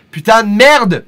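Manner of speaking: loud, high French curse; French cursing. Pretend. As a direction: blurt it like an outraged Parisian. Mock French cursing at chat.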